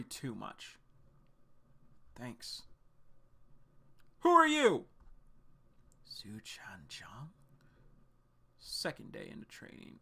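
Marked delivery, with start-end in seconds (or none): 4.2-4.8 loud, high startled cry; shouting in alarm at the newcomer. Acted. Startled by a stranger. 6.0-7.3 slow, breathless whisper of a name; whispering the name in awe; awed whisper. Acted.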